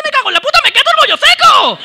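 muy agudo y fuerte, rabieta de niño; niño cabreado. Retold or imitated. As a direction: estalla como un crío indignado. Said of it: "decía". (rabieta aguda y fuerte de crío)

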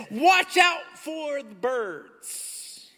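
high, slow shouted warning. Acted. Pastor voicing Jesus warning the crowd, slow shout.

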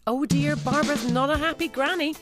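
ordinary delivery

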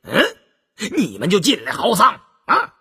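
a servant's sharp, rising scold. A narrator's reading. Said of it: "忙赶过来呵斥道".

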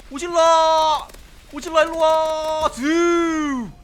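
calling out loudly. Real, not acted. Calling out to Godzilla.

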